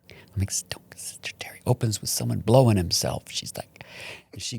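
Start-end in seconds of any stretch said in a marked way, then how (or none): 0.7-1.6 hushed mock plea; pleading whisper. Nephew begging aunt not to come, desperate whisper. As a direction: whisper it in comic dread.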